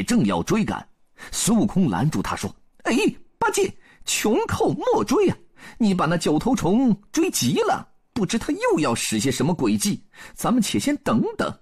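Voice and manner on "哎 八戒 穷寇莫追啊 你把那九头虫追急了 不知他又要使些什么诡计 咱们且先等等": high, quick-witted Monkey King cautioning a companion; 孙悟空 advising. A narrator's reading. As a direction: pitch it high, like the Monkey King laying out a plan. (高嗓门孙悟空，劝阻同伴)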